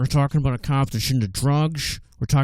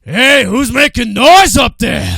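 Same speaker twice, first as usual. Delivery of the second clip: slow, much higher, much louder shout; Pacino yell; 9 semitones higher, 12 dB louder, slower than usual. Acted. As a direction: roar it out like late-career Pacino. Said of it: "That's my late, later life Pacino".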